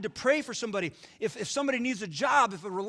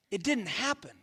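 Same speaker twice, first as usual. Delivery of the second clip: ordinary talk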